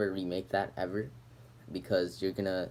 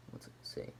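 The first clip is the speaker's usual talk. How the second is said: whisper; 14 dB quieter than usual. Real, not acted. Muttering while squinting at label.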